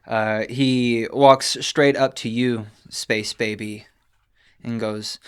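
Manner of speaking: ordinary talk, nothing marked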